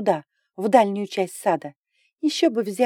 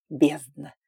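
dragon growl; 4 semitones lower than usual. A narrator's reading. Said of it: "рыкнул дракон".

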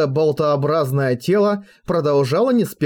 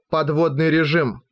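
ordinary delivery

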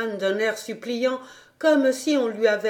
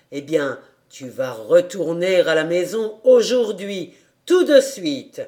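cheerful gentleman; 3 semitones lower, 5 dB louder than usual. A narrator's reading. Monsieur annonce le départ.

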